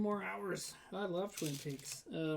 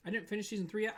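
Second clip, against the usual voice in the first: ordinary talk, nothing marked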